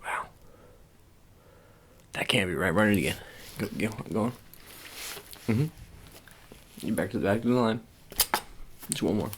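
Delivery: normal talking voice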